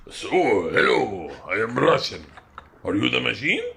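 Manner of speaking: slow, stretched-out mock Russian accent. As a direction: attempt a shaky-grasp Russian accent.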